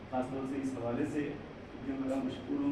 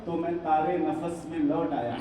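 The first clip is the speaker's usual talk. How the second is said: raised, projected Urdu verse declamation; poetry recital; 6 semitones higher, 11 dB louder than usual. Acted. Poet repeating lines for a cheering audience, declaimed ghazal. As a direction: recite to the hall, leaning on the refrain.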